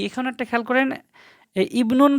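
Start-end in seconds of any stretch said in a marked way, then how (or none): none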